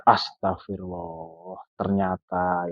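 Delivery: hushed, low astonished Astagfirullah; shocked gasp. A narrator's reading. Pak Rudi startled by the stranger's confession, gasping low. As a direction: breathe it out in shock, low and soft. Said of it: "Pak Rudi ini kaget".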